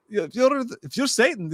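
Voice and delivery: high mock Italian accent; Italian exorcist baiting a demon; Russell Crowe. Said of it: "that's kinda how he's talking".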